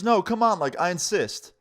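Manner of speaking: pressing someone who has refused; insistent stranger. Retold or imitated. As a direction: act out pushy coaxing. Stranger insists after being refused.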